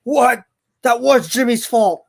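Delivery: drawn-out, put-on indignant protest; mock outrage. Streamer imitating viewers who miss the sarcasm, mock outrage. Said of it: "They're gonna be like".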